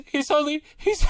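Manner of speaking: laughing. Retold or imitated. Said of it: "his only response is".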